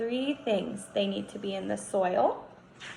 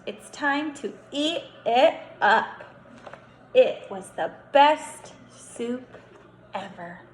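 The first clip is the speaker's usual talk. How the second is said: slow storybook emphasis; 3 semitones higher, 6 dB louder, slower than usual. Acted. Storybook ending read to kindergartners.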